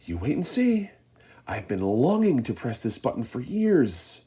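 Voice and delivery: high, eager voice building loud; Mister Wonka gleeful. A narrator's reading. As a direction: savour the big moment. Wonka gleeful before launch.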